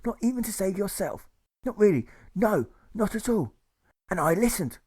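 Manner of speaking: high, eager pleading voice; Bernie pleading. A narrator's reading. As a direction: coax a friend, voice up high.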